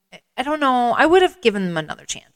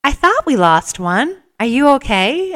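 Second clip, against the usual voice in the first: higher, louder friendly voice calling out in the dark; a kind runner finding a lost one, pitched up; concerned friendly stranger, higher and louder; 7 semitones higher, 6 dB louder, slower than usual. Retold or imitated. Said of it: "this friendly voice says".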